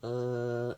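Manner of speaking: level, steady first tone on uh; flat first tone. Shown as a demonstration. Teaching Chinese tones, demonstrating the flat first tone. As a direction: hold uh flat like Mandarin tone one. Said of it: "just makes that, uh, sound".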